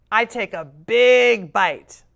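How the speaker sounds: ordinary delivery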